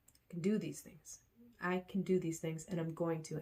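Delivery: soft, low self-reassurance; quiet affirmation. Real, not acted. Vlogger talking herself up after a hard workout, softly reassuring. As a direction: reassure yourself gently and quietly. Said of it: "self affirmation".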